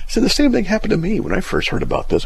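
ordinary talk

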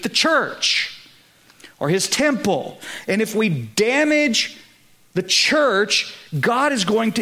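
fervent sermon climax; preaching at full force. Acted. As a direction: drive it home like a revival preacher.

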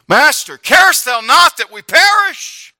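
high, slow, desperate shout; panicked cry. Acted. Disciples in the storm crying out to their master, shouting slowly. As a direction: shout it like men about to drown.

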